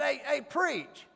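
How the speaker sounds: high, slow call across the office; mock holler. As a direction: call out to him from the next room. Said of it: "they just holler".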